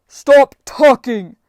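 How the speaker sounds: slow, loud, drawn-out roar; a giant roaring over the deal-making; furious shout. Acted. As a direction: bellow it to shut them up. Giant fed up with the bargaining.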